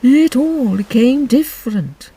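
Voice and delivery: slow, musing Mock Turtle; thoughtful. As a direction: turn it over slowly in the mind. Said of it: "repeated thoughtfully".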